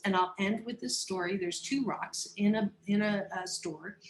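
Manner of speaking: ordinary talk, nothing marked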